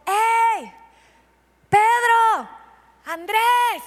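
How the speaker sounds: hailing fishermen from the beach; calling out. Retold or imitated. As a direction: shout the names from the shore. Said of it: "yo creo que les gritó".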